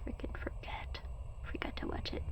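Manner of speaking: soft unvoiced whisper to herself. Real, not acted. Breathe it out under your breath. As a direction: streamer mutters about missing the Christmas movie, whispered.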